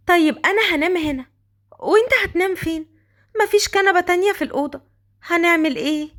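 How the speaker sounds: put-on Qadr, high girlish voice. The girl complaining to Raad his clothes don't fit, high girlish voice. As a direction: speak up high like a shy young girl.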